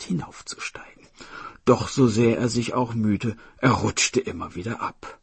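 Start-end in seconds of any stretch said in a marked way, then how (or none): none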